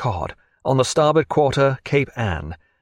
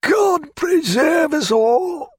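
put-on high, slow, scandalised old clerk's cry; grumbling old clerk; much slower than usual. A narrator's reading. Old clerk leaving the captain, horrified by the marriage. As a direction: appeal to heaven in horror.

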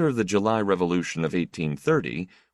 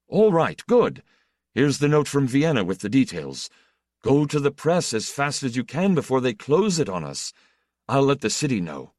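loud, high, rapid commands; brisk excited orders; 7 semitones higher, 4 dB louder than usual. Acted. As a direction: snap into action, all business. Deputy gives quick orders.

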